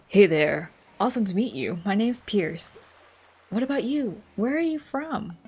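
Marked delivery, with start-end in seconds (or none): 0.1-2.8 fix the voice, bring it down and make it bold; corrected villager voice. Shown as a demonstration. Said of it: "Oh, that's not his voice".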